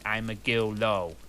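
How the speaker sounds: normal talking voice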